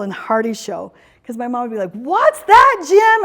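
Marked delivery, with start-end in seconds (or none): 1.9-3.2 loud, high shout; mom shouting. Retold or imitated. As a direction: call out loud and high to a nearly deaf husband. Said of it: "he'd be trying to shout".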